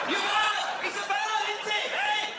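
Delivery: high, loud yelled punchline; hammed-up comic yell; punchline shout. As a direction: bellow it at the audience.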